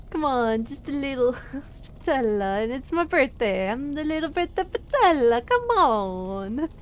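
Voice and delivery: hammed-up Italian accent, swooping up; comic sing-song Italian accent. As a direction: plead playfully in a cartoon Italian accent. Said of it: "in a Italian accent".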